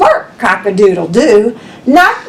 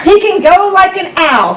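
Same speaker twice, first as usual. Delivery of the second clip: usual speaking voice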